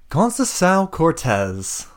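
high, slow, drawn-out name; lightly voiced villain calling through a fire. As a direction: summon someone slowly by full name.

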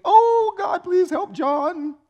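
high, slow, hammed-up pleading; begging Jesus. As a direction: whimper it like a desperate beggar. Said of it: "he's shaking a little bit".

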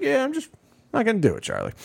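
high, sing-song dismissive no; mock refusal. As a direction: turn him down in a teasing voice. Seller refuses in acted quarrel.

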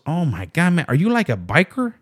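the Mexican driver, startled at the rider; put-on Mexican accent; Mexican accent impression. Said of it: "stereotypical Mexican accent".

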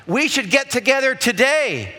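high, emphatic friendly offer; genuine invitation. Acted. Someone making a real offer to meet, keen invitation. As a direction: invite them eagerly, landing on "today".

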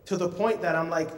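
ordinary talk

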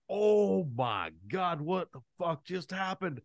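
slow, high, stunned exclamation; shocked disbelief. Retold or imitated. Retelling his shock at the movie opening, slow and high. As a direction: drag it out like you can't believe your eyes. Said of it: "I was that shocked".

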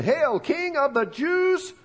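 high, slow mock salute; mocking hail. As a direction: sneer it out like a jeering soldier. Soldiers mocking Jesus.

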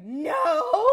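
loud, high "No." as the crying breaks through; breaking into sobs. Retold or imitated. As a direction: let the sob burst out on the word. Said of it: "And I start sobbing".

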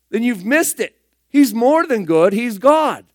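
ordinary talk

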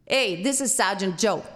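lightly voiced gruff New York cop; New York sergeant. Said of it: "a New York tater hater".

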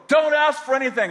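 mother yelling a warning; mom calling back. Retold or imitated. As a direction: call back sharply from another room. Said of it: "And she go".

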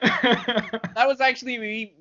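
laughing. Real, not acted. Still in character, laughing.

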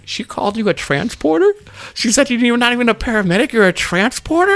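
high-pitched teasing disbelief; mock disbelief. Teasing the colleague who got called a transporter, mock disbelief. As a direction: repeat the insult high, as if incredulous.

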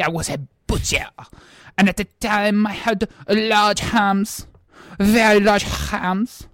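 ordinary talk

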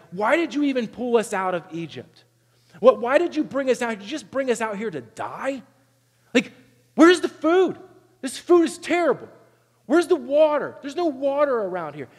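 high, petulant whine building to a shrill peak; mock the grumbling people whining to God; whiny complaining Israelites. Israelites grumbling at God in the desert, high whiny complaint. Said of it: "they just start complaining".

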